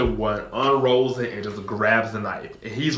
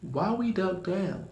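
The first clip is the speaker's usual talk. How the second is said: unimpressed; 5 semitones higher, 5 dB quieter, slower than usual. Retold or imitated. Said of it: "I was like".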